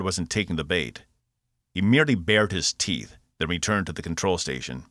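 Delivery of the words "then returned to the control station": usual speaking voice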